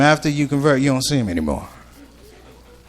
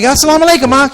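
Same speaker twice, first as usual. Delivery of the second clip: higher, louder salaam; eager greeting; 10 semitones higher, 6 dB louder, faster than usual. Retold or imitated. Comic greeting the security guard, eager high salaam. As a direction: greet him brightly.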